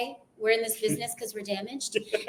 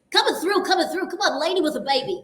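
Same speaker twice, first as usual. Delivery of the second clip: higher, brash movie voice; Grease impression; 7 semitones higher, 3 dB louder than usual. Actor quoting Grease for a co-host, brash higher voice. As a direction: barge through a crowd, film-quote style. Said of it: "all of Grease I could do".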